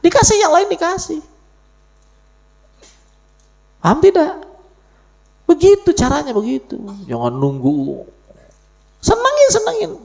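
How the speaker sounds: loud, high-pitched call to the crowd; high ringing exhortation, loud and urgent; high preaching shout. Real, not acted. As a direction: belt it out high like a fired-up preacher.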